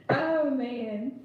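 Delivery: usual speaking voice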